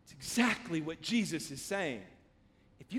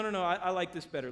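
ordinary delivery

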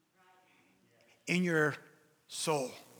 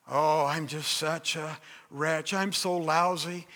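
slow, lower, self-pitying moan; mock woe-is-me martyr voice; martyr syndrome; 6 semitones lower, slower than usual. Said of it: "You know that martyr syn-, syndrome?".

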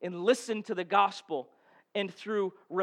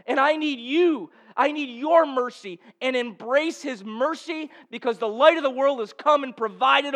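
impassioned preacher, pressing the point home; preaching at full force; 5 semitones higher, 6 dB louder than usual. Acted. Pastor urging the congregation to repent, impassioned preaching. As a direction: preach it out with fervour to the church.

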